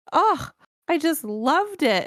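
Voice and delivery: high, slow sweet gushing voice. Retold or imitated. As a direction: gush sweetly like a lovely older lady. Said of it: "And she was like".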